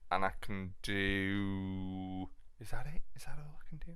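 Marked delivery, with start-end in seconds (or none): 2.6-3.9 soft whispered self-question. Real, not acted. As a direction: ask yourself under your breath. Whispered aside.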